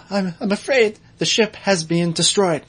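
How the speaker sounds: prim worried butler droid; C-3PO voice. Retold or imitated. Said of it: "C three P O says".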